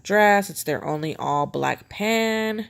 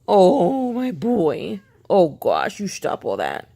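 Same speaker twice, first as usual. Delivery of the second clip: cooing at cat; 3 semitones higher, 4 dB louder, slower than usual. Real, not acted.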